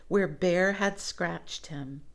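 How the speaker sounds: usual speaking voice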